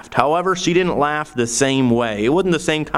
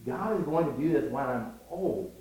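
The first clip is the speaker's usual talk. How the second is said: very soft inward murmur; hushed aside as an inner thought; private thought; 11 dB quieter than usual. Retold or imitated. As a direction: think it to yourself, barely voiced. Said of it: "he began to think".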